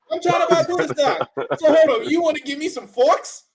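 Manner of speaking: high, loud disbelief; incredulous. Acted. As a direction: act outraged at the idea. Mock outrage at gift idea.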